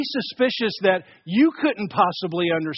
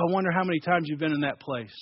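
ordinary talk, nothing marked

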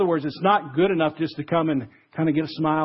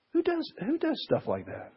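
incredulous; 11 semitones higher, 8 dB quieter than usual. Real, not acted. Preacher marvelling at the offer.

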